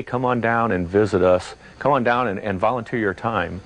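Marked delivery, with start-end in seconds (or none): none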